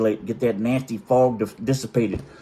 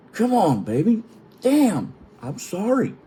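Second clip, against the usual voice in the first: pleading protest; 9 semitones higher, slower than usual. Retold or imitated. Retold pleading with wife.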